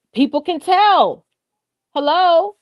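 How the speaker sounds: high, drawn-out emphatic exclamation; loud excitement. Real, not acted. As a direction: pitch it up and stretch it, excited to make the point. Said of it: "Am I loud? ... I'm just excited".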